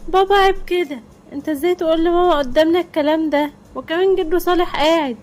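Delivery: put-on high, loud child's voice telling dad off; kids scolding. Children scold father before grandpa.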